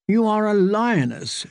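a hint of a proud older aunt; stern aunt. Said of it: "she said".

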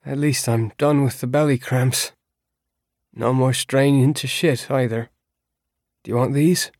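lightly voiced prisoner. A narrator's reading. Voiced character in read story.

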